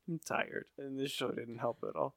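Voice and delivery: soft, worn-out admission; tired, spirits killed. Real, not acted. Co-host teased about killed spirits, answers softly and wearily. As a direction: trail out quietly, drained of energy. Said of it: "It has just killed your spirits".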